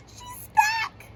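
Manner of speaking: very high, trembling-cub wail; Baby Bear. Acted. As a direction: wail it like a frightened little bear. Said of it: "he wailed".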